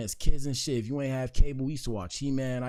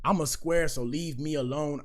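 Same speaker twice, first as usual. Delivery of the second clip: ordinary talk, nothing marked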